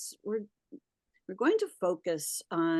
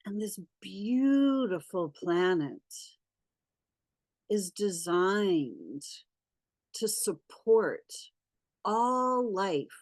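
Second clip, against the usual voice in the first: guided meditation. Acted.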